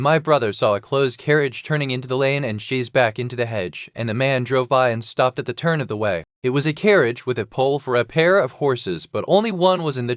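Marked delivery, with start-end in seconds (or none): none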